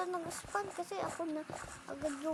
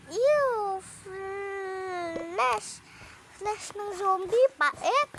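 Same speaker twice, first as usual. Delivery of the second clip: disgusted; 2 semitones higher, 6 dB louder, much slower than usual. Real, not acted. Disgusted by zombie flesh.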